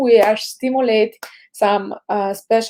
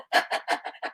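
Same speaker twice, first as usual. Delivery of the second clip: quick, unvoiced panting breaths; short voiceless huffs from the diaphragm; dog panting; 10 dB quieter than usual. Shown as a demonstration. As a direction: pant like a dog after running. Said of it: "we go".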